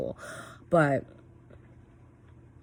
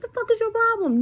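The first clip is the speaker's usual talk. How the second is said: very high, loud re-enacted yell; retold yell; 7 dB louder than usual. Mom re-enacting yelling at her son, exasperated yell. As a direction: mimic losing it at someone. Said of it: "I was yelling at him".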